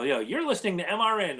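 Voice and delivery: a light mock radio DJ; radio personality. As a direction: point and announce like a DJ. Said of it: "like a radio personality and go".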